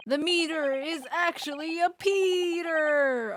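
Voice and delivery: loud, slow, high gotcha; triumphant reveal. Acted. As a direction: announce it like a big twist.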